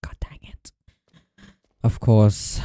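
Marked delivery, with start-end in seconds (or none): 0.0-0.7 breathless whispered curse; frustrated whisper to self. Real, not acted. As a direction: hiss it under the breath. Whispered curse in horror game.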